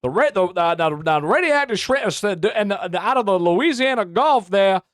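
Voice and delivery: mocking the guest as a blustery cartoon rooster; Foghorn Leghorn. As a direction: ham up the stammering cartoon rooster. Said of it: "It almost sounds like he's Foghorn Leghorn".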